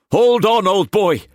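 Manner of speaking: high, pressing plea; urgent comfort. A narrator's reading. Cullum urging the wounded Glessing, pressing. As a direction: keep him with you. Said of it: "he muttered".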